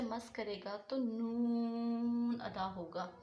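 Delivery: नून drawn out slowly, nasal hum held; noon sound demo. Shown as a demonstration. Tajweed teacher showing students the नून, slow drawn delivery. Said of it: "ज़ुबान का किनारा और ऊपर वाले दांतों के मसूड़े".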